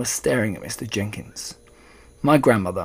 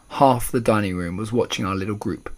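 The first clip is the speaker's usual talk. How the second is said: ordinary delivery, nothing marked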